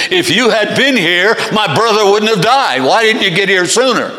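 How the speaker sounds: loud, high, accusing outburst; upset, reproachful demand; Martha's rebuke. Acted. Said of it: "It's a rebuke from Martha to Jesus".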